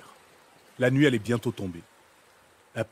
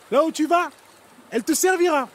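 very high, loud bird call; seagull cry voice; 5 dB louder than usual. A narrator's reading. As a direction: cry it out like a gull. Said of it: "cria-t-elle en s'envolant".

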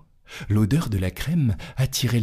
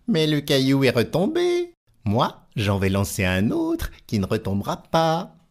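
scoffing tailor; 6 semitones higher than usual. Acted. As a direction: brush it off as child's play. Tailor boasting.

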